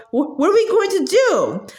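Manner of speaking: high, breaking into treble; cracking voice. Acted. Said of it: "Dennis's voice ... to a piercing treble".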